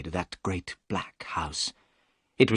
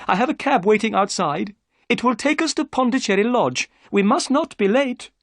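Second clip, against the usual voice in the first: higher, eager gentleman's voice; Thaddeus Sholto; 12 semitones higher, 5 dB louder than usual. A narrator's reading. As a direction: voice the excitable host. Sholto's voice in the story.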